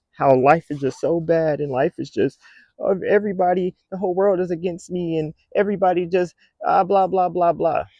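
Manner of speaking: high, loud whiny parody of a moaner; mock the self-pitying crowd; lightly voiced whiner going blah blah; mocking whiny complainer.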